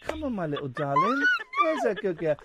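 a light coaxing cat voice aimed at a colleague; mock coaxing pet voice. Host teasingly coaxing his co-host Catherine like a cat. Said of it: "I'm saying that, and I'm making eye contact with Catherine".

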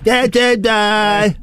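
slow sung-out imitation; imitating background singer. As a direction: drawl it like the distant singer.

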